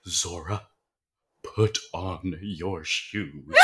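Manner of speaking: slow, low, soft mock-mother command; every word spaced out, quiet and low; hushed urgent order. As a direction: imitate a mother giving a deadly serious instruction. Acted mother ordering child to flee.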